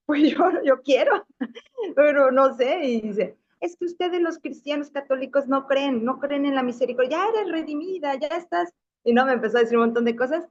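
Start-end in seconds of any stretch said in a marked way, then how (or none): 0.1-2.8 higher, hesitant reply with a laugh; sheepish laughing answer. Retold or imitated. Su respuesta tímida retomada. 3.6-8.7 high, softer lecturing voice; mock preachy questioner. El cristiano la sermonea.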